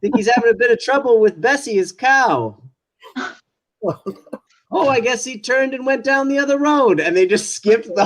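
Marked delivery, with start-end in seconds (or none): 0.0-2.6 lightly voiced improvising actor, higher and quicker; covering actor onstage. Stalling onstage for the missing actor, quick and higher. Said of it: "he's looking down the bomb and goes". 4.7-7.0 a put-on higher stage voice; stage actor giving up. Onstage actor skipping the scene, theatrical higher voice. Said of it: "he's looking down the bomb and goes".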